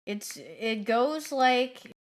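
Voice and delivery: ordinary talk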